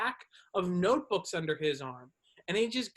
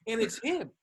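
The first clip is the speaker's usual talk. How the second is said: normal talking voice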